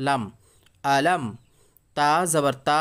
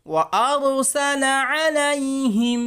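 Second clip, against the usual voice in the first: high, slow chanting of আলাইহিম; teacher chanting the next words; melodic recitation; 9 semitones higher, 3 dB louder, much slower than usual. Shown as a demonstration. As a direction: sing out the words slowly, Quran style. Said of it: "কেমনভাবে পড়া হচ্ছে দেখেন".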